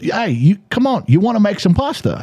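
high-pitched mock Australian accent; thick Australian accent. Host imitating friend repeating himself outside lunch, thick Australian accent. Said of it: "remember, thick accent".

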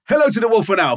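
"Hello to the Wolf and Owl" read with extra swagger; showy hammed-up email read; with some stank. Shown as a demonstration. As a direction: put some stank on the greeting. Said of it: "wanna put some stank on this".

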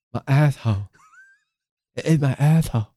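words slurred by a held tongue; holding tongue. Pretend. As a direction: keep holding your tongue. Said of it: "Why are you holding your tongue".

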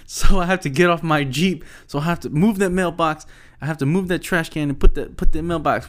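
high, loud mock rant; venting. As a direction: imitate someone airing a grievance, pushing each complaint. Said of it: "he's just venting".

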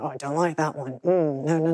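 low, soft grumbling student; disapproving student. Retold or imitated.

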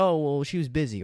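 high, loud mock excuse. Mocking guys who excuse a girl ignoring them, in a high loud voice. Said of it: "a lot of guys will be like".

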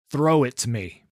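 loud urgent bark; barked command. Acted. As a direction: bark it out to her. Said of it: "Lucian barked".

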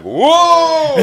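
high, loud startled whoa. Retold or imitated. Fisherman to friends, retelling the bite with a high, loud startled whoa. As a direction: yelp it as the rod suddenly bends. Retold shock at fish pulling.

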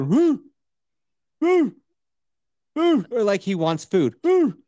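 mock dog woof; dog bark imitation. Imitating the old dog barking.

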